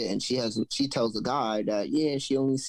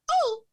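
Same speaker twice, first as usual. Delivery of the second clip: high startled cry, imitated. Girlfriend waking him, imitated high startled cry. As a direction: mimic her alarmed high "Oh". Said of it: "I hear my girl".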